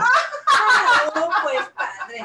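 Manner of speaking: laughing while protesting; laughing, mock hurt; laughing through pain. Real, not acted. Teased about favorite, laughs.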